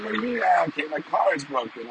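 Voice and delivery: high, mock whiny protest; imitating upset daughter. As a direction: pitch up into a lightly voiced upset teen. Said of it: "And she's like".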